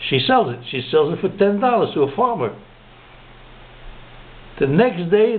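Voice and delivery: usual speaking voice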